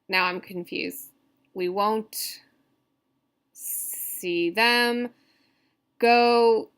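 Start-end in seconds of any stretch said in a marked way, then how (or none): 1.5-6.7 "We won't see them go" slow, with long gaps; halting, measured syllables in "We won't see them go"; scansion reading. Shown as a demonstration. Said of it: "So stress and unstressed".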